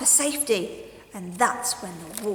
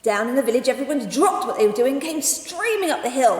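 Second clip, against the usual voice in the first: plain reading voice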